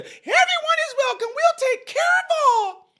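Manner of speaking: very high-pitched mocking falsetto; mock welcome. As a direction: squeal it in a silly high voice, drawing out the end. Mocking sanctuary city welcome.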